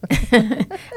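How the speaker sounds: high, loud laugh; burst of laughter. Real, not acted. Host laughs at the menso joke, loud laugh.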